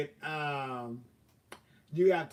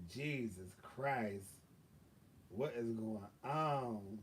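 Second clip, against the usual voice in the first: weary exasperation; 5 semitones lower, 8 dB quieter, slower than usual. Retold or imitated. Exhausted after car troubles.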